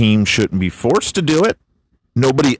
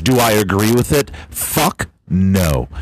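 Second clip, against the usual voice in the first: ordinary talk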